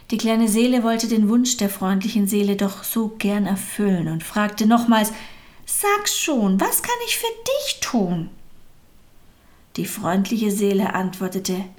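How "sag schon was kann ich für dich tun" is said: very high, small eager whisper; eager little soul. A narrator's reading. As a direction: ask like an eager small child. Little soul eagerly asks again. (hoch und eifrig, kindlich)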